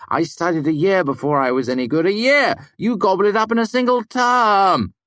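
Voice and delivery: high, loud, mounting complaint; indignant protest. A narrator's reading. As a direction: build to an outraged high cry.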